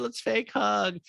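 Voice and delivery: high, quick mock-friendly voice; Kyle. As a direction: lightly parody a phony friend. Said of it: "Kyle's like".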